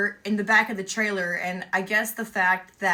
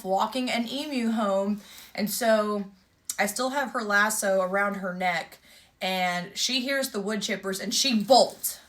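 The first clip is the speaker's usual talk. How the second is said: ordinary talk, nothing marked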